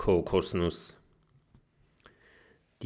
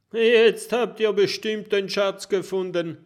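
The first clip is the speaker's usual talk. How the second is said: mocking; 10 semitones higher, 7 dB louder than usual. A narrator's reading. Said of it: "spottet Jim".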